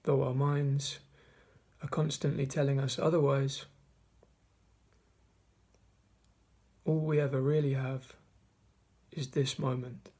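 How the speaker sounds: measured meditation guide, long pauses between phrases; guided meditation. Acted. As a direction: guide listeners through the breath, leaving space after each phrase. Leads a mindfulness meditation.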